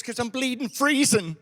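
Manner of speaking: put-on slow, high Irish accent punchline; Irish cub. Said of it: "Maybe a little Irish polar bear".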